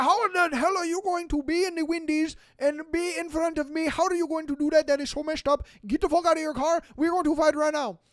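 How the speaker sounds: put-on high, heated rant in a Middle Eastern accent; hammed-up irate driver picking a fight; mock yelling. Rear-ending driver challenging them to fight, mock yelling. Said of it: "he is yelling".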